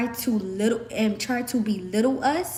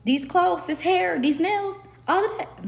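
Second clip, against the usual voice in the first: proud boast; 7 semitones higher than usual. Real, not acted. Boasting about what she owns.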